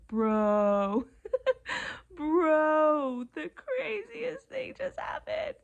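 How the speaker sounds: loud "bro", then high, thin, slow squeal; overwhelmed excitement. Retold or imitated. Retelling her text to her roommate, overwhelmed squealing excitement. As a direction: wail it like mock crying, with a laugh, thinning out high. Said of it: "I was like".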